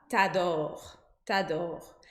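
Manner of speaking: t'adore clipped, the U swallowed; street French t'adore. Shown as a demonstration. Teacher shows the casual street t'adore. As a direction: say t'adore fast as one word. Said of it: "com T apóstrofe e pronunciado".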